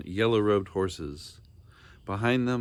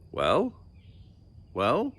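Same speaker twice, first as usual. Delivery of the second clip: a higher, impatient rising demand; demanding king; 5 semitones higher than usual. A narrator's reading. King staring down at Bartholomew, impatient demand. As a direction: press the boy for an answer. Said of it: "he demanded".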